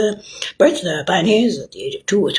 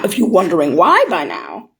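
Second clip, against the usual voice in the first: usual speaking voice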